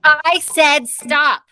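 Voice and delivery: slow, loud, high I said stop; mother's patience snaps, loud and slow; stern shout. A narrator's reading. Mother at the dinner table snapping at the kids, slow loud shout.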